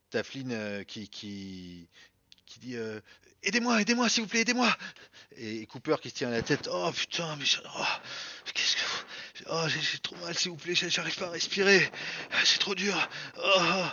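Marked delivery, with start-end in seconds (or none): 3.4-4.7 high frantic cry for help; panicked plea. Acted. GM voices Flynn pleading. 6.7-13.8 gasping, panicked, short of air; can't breathe, pleading; hyperventilating. Acted. GM voices Cooper suffocating.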